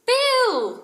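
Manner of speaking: very high, loud delighted shout; joyful cry. A narrator's reading. The children seeing Bill at the door, joyful cry. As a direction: cry his name with joy. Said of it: "cried four voices".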